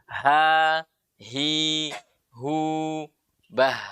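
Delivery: HA, HI, HU, BAH with light vowels and airy h; evenly drilled light ha sounds; thin ha. Shown as a demonstration. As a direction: breathe out a thin h on each syllable. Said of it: "ha ini huruf tipis".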